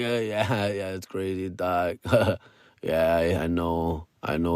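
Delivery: mumbled low and quiet, laughing through it; a dazed, giggly stoner brushing someone off; stoned mumble. Retold or imitated. Quoting himself too high to talk.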